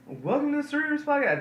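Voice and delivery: high hammed-up announcer voice; mock announcer intro. Host retelling how he opened the show, mock announcer. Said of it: "I was just like".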